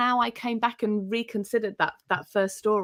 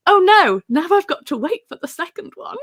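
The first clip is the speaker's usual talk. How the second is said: mock dismay; 11 semitones higher, 9 dB louder than usual. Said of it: "I was like".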